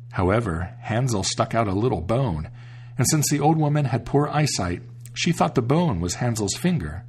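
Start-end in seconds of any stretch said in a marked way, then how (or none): none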